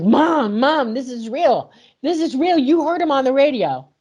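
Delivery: high, urgent pleading with mom, retold; teen pleading with mother over the radio report, high and urgent; desperate pleading. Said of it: "I started crying, I started screaming".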